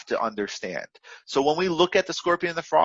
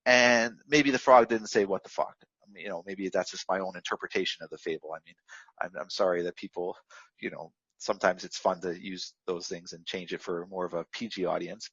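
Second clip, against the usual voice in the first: much softer and lower, apologetic; sheepish aside; 8 semitones lower, 6 dB quieter than usual. Real, not acted. Speaker excuses the frog's swearing to the audience, sheepish aside. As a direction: drop into a quiet, low aside.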